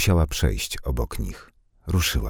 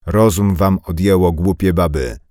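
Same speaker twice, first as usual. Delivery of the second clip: sharp, much louder rebuke; like a whiplash; 4 semitones higher, 7 dB louder than usual. Acted. Carpenter stopping the women, loud whiplash rebuke. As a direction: crack it out like a whip. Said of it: "męski głos powiedział jak smagnięcie batem".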